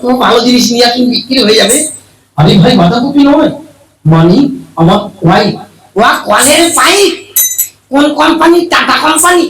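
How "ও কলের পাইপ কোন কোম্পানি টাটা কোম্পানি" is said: high silly voice. Acted. Comic mishearing, high-pitched reply.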